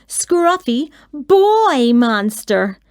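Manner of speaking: ordinary delivery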